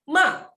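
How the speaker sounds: "ma" high, loud and sharply falling; fourth tone. Shown as a demonstration. Teacher repeats the word for scold, sharp falling tone. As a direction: hit "ma" high and drop it. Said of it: "Pakai nada empat".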